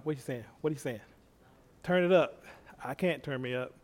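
usual speaking voice

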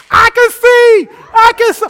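high, loud overjoyed shout; joyful shout. Retold or imitated. Healed blind woman to the crowd, shouting for joy. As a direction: cry it out in amazement.

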